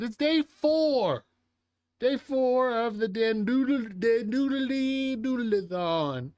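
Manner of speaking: high, loud and slow, with a hammed-up showman tone; excited announcement. Opening day four of the stream event, hammed-up announcer. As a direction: announce it like a big event host.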